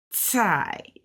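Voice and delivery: "Tsai" with a long hissed ts onset; stretched T in TSAI. Shown as a demonstration. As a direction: stretch the ts before the vowel in "Tsai". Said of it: "stretching that T a little further".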